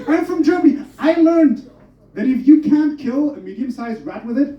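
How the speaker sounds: ordinary talk, nothing marked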